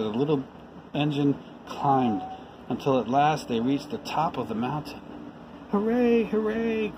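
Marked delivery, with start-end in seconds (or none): 5.7-6.9 much higher, joyful cheer; high gleeful hooray. Acted. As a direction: cheer like excited toys. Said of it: "cried the little clown".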